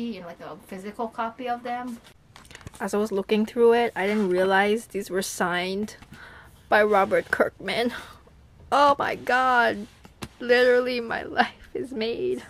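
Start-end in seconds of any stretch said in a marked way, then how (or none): 2.8-12.4 real excited shout, much louder, rushing then slowing; blurting it out, overwhelmed with joy; shouting with thrilled disbelief; freaking out. Real, not acted. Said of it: "freaking out and spazzing".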